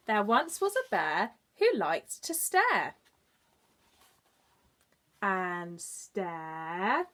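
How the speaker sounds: slow, drawn-out read-aloud with long pauses; storybook reading. A narrator's reading. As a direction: read it like bedtime for little ones. Reads story to children.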